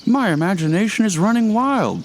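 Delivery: high, tense and nervous; scared. A narrator's reading. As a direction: talk yourself out of panic, pitched high. Frightened man talks to himself.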